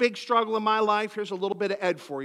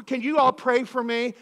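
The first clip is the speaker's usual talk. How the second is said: ordinary delivery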